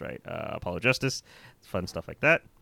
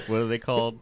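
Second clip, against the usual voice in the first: normal talking voice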